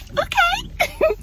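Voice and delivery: high, drawn-out flattered okay; very high giggly okay. Retold or imitated. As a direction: squeak it out, bashful and pleased.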